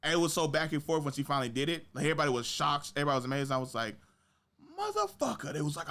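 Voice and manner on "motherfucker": very high-pitched mock outrage; comic, squeaky exasperation; retold exasperated curse. Retelling anger at being spoiled.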